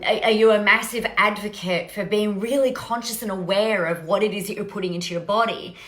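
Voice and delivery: ordinary talk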